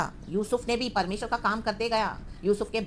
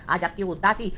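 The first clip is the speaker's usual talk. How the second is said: normal talking voice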